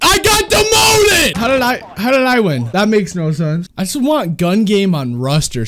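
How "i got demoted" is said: high, slow, drawn-out outraged yell; frustrated shout. Real, not acted. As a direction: wail it like you can't believe it. Angry at losing a rank.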